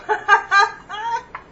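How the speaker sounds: a rising burst of laughter; high laugh. Real, not acted. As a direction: laugh out loud at the mess. Laughs at the failed sauce.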